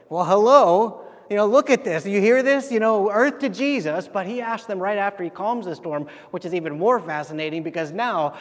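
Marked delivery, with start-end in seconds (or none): 0.1-4.1 loud, higher-pitched exasperated disciples; mock-incredulous retort. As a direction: call out in sarcastic disbelief over the storm. Disciples' retort to Jesus amid storm.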